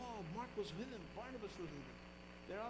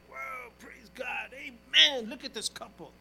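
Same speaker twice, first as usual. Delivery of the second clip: excited pastor; 4 semitones higher, 10 dB louder, slower than usual. Retold or imitated. Pastor thrilled by new couple.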